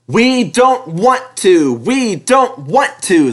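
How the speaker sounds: slow, sulky chant; grumbling trucks. A narrator's reading. Trucks grumble at Percy as he races. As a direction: drag your feet. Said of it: "they grumbled".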